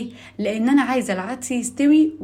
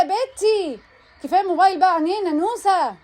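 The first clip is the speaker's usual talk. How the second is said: yelling across the room; 7 semitones higher than usual. Real, not acted. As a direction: nag her from another room. Mother scolding daughter from afar.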